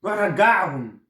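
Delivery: a son protesting he will never wear them; indignant son. Acted. Son mocks uncle Sayed deal.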